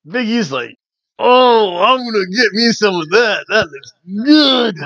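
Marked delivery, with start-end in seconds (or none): 1.2-4.8 hungry excitement. Retold or imitated. Big E eyeing food in a wrestling recap, hammed-up hungry excitement. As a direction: savour it greedily, stretch the last word.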